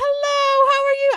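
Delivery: high, loud attempt at British; attempted British accent. Friend retelling the actor's greeting, attempted British accent. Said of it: "I can't do a British accent".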